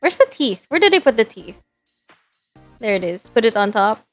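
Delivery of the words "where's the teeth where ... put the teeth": normal talking voice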